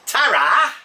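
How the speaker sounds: high, loud, singsong English ta-ra; Pommie English farewell. Shown as a demonstration. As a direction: belt out a jaunty Pommie goodbye. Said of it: "as us Pommies say is".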